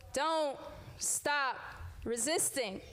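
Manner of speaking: normal talking voice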